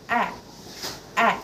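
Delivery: A as a short open "a" with the jaw dropped. Shown as a demonstration. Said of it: "You need to drop your jaw".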